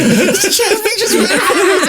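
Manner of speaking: high, giddy, laughing disbelief; excited whisper-shout. Retold or imitated. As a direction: squeal it to your friend. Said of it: "hitting me like".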